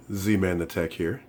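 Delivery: normal talking voice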